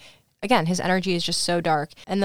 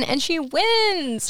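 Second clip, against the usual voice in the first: excited announcement; 10 semitones higher, much slower than usual. Real, not acted. Announcing the race win.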